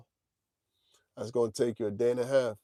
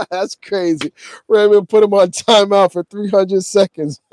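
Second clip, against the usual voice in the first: loud, high voice full of laughter; amused, laughing; 7 semitones higher, 16 dB louder than usual. Real, not acted. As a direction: crow it through real laughter. Cracking up over the timeout.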